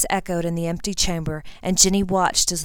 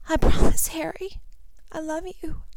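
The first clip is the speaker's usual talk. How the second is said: high, breathy, fading farewell; Ginny promising dying Harry; tearful girl; 11 semitones higher than usual. Acted. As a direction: whisper it through tears.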